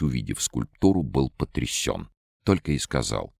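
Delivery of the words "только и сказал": ordinary delivery, nothing marked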